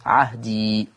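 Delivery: عهدي ending on silent ya, slowly; stop form. Shown as a demonstration. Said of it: "بنسكنه". (الوقف على عهدي بالسكون)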